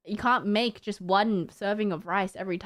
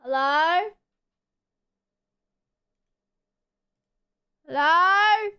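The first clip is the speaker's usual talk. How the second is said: louder, higher calling hello; 7 semitones higher, 5 dB louder, slower than usual. Real, not acted. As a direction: call out to a lagging stream. Lagging, calling out.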